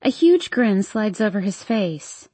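ordinary delivery

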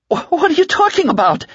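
high, loud startled cry; cried out. A narrator's reading. Larry crying out at Ralph, startled and loud. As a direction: blurt it out in alarm. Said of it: "Larry cried".

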